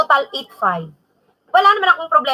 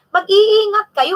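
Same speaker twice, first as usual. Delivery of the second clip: emphatic warning; 6 semitones higher, 4 dB louder, slower than usual. Real, not acted. Warning viewers about scammers.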